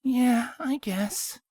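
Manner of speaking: sighing. A narrator's reading. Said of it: "Carrot Top sighed".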